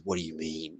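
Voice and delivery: low, hushed action-hero growl; Blade voice. Retold or imitated. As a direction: drop it low and quiet like Blade. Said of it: "the Blade voice".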